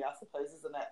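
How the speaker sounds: ordinary talk, nothing marked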